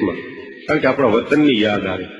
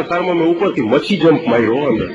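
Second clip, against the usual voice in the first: ordinary delivery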